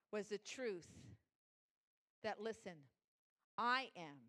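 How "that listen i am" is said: ordinary talk, nothing marked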